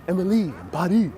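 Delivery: Paris said the French way so it rhymes with Emily; light French accent on Paris; Parisian French accent. Shown as a demonstration. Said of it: "pronounce it in the typical Parisian accent".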